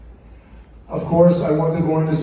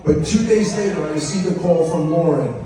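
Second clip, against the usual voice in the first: usual speaking voice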